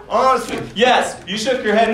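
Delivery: ordinary talk, nothing marked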